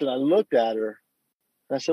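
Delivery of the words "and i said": ordinary talk, nothing marked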